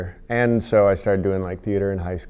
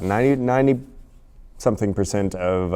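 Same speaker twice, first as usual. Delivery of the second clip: ordinary talk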